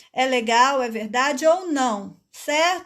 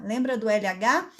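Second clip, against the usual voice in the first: normal talking voice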